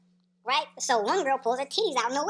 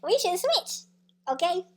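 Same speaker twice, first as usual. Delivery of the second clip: pitched way up, teasing the idea; high mocking echo; 7 semitones higher, slower than usual. Repeating the friend's swap idea to listeners, high and incredulous. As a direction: squeak it out like a scandalized echo.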